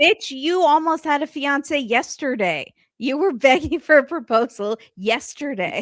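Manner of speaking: loud, high mock telling-off; incredulous scolding. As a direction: call her out, laughing at the absurdity. Acts out scolding her.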